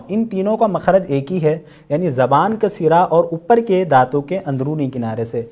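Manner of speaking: ordinary delivery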